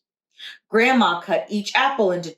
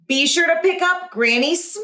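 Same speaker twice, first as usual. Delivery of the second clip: calling out; 8 semitones higher than usual. A narrator's reading. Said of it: "Grandma called out".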